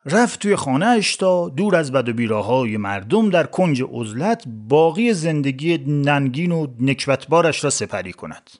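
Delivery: plain reading voice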